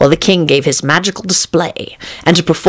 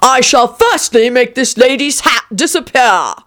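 high-pitched royal proclamation; crying out, king; 9 semitones higher than usual. A narrator's reading. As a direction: announce the trick like a proud king. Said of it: "cried the king".

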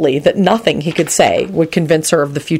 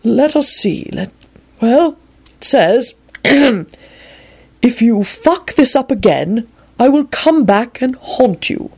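put-on slow, high old man reading aloud; old wizard reading; 8 semitones higher, much slower than usual. A narrator's reading. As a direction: read the rude note out ceremoniously. Dumbledore reads Sirius's note.

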